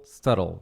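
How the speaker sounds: low, falling "subtle", said SUH-rol; matching pronunciation of subtle. Shown as a demonstration. Teacher alternating it with little, "subtle" as SUH-rol. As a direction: drop pitch on "subtle", leave out the B. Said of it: "que é parecido".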